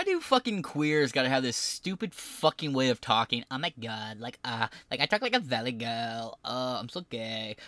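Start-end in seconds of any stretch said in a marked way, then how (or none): 3.5-7.6 mocking valley girl. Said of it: "Why do you gotta talk like that".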